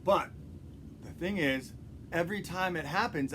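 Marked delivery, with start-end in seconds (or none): none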